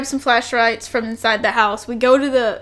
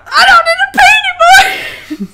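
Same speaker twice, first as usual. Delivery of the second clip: much higher and louder, laughing; laughing shout; 9 dB louder than usual. Retold or imitated. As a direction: shout it while cracking up. Teller's own fright, laughing.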